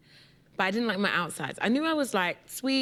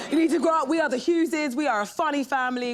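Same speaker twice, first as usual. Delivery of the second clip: scolding; 6 semitones higher, 5 dB louder than usual. Retold or imitated. Family scolding her as kid.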